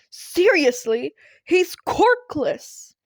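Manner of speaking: high, slow, airy disbelief; incredulous declaration. A narrator's reading. All Might stunned that the kid is quirkless. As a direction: stretch out the shock. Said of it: "he declared".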